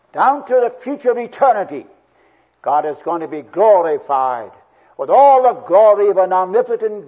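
high, loud pulpit proclamation; fervent preaching. Real, not acted. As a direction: lift the voice and proclaim it to the congregation. Preaching climax at full force.

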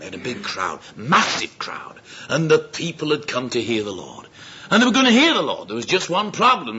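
normal talking voice

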